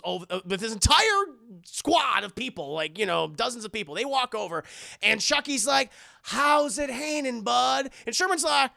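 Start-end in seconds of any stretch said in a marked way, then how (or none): 6.2-7.9 high, loud, slow mock-casual hail; taunting greeting. Chucky greeting the approaching squad, taunting greeting. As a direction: draw it out like you own the moment.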